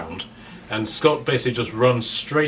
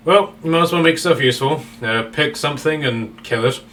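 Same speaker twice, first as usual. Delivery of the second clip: louder, higher and fast, impatient; Scott's brusque order; 4 semitones higher, 4 dB louder than usual. Acted. Scott ordering the newcomer to fight, brusque and quick. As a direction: bark it off quickly, fed up.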